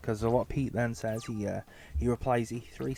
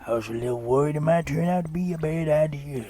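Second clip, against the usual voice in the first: creepy dad voice; 4 dB louder than usual. Acted. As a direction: sound like a deeply dodgy father. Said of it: "making them both sound like they're on a register".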